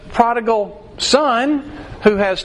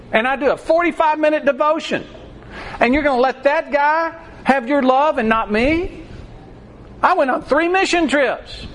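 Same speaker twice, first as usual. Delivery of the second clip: higher, whiny self-righteous complaint; indignant older son; 5 semitones higher than usual. Acted. Older son protesting to his father, higher indignant whine. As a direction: play the jealous brother listing his good deeds.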